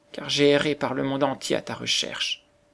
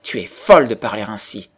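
louder, angry cry; angry outburst; 8 dB louder than usual. A narrator's reading. Star child angrily rejects the beggar woman, angry loud cry. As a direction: snap back at her in anger. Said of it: "s'écria avec colère".

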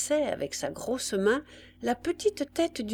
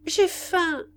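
put-on higher-pitched child's complaint; child's cry; 10 semitones higher, 4 dB louder than usual. A narrator's reading. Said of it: "les deux enfants se réveillèrent et crièrent". (cri d'enfant affamé)

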